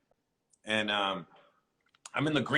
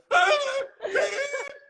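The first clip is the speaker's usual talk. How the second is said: high laugh; 4 dB louder than usual. Real, not acted. Laughing at his own story.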